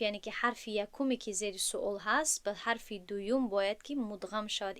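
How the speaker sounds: normal talking voice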